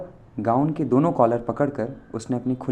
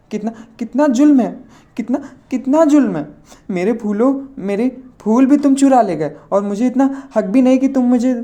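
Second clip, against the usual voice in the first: put-on high, loud weepy old man wailing; tearful whine; 11 semitones higher, 6 dB louder than usual. A narrator's reading. Old gora complains to Chandar, high tearful old-man wail. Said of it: "बड़ी ही रोनी आवाज़ में बोला".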